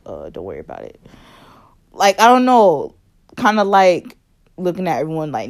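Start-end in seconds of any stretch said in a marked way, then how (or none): 0.0-1.0 low, soft, offhand brush-off; lightly voiced careless cousin; dismissive cousin. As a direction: shrug it off at the kid. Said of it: "he's just like".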